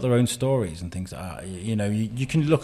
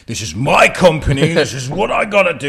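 bossy boss; 4 semitones higher, 7 dB louder than usual. Retold or imitated. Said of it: "you're not like".

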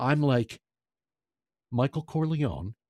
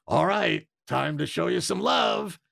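Pacino quote; 6 semitones higher than usual. Retold or imitated. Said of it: "and he goes".

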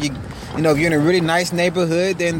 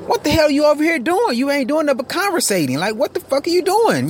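pitched way up, incredulous and fed up; exasperated rant; 11 semitones higher than usual. Real, not acted. To the chatting clerks who ignored him, a high exasperated rant. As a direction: let the outrage spill over, half to himself.

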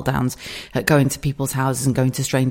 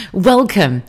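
higher, louder welcome, the bigger take; 5 semitones higher, 6 dB louder than usual. Shown as a demonstration. Host trying a better welcome, higher and louder. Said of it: "let me welcome you off a little bit better".

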